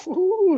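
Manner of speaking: very high giggling coo; giggling gnome coo. Acted. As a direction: giggle and coo over treasure. Gnome tickling the bracers, giggling.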